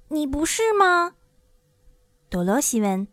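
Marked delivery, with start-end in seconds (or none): 0.1-1.1 put-on high, incredulous girl; high-pitched storybook child; questioning Dorothy. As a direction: ask in a raised girlish voice.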